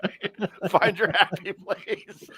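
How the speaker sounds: high-pitched words through laughter; laughing. Real, not acted. Friend joking about surviving dad's anger, laughing.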